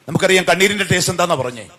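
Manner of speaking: ordinary delivery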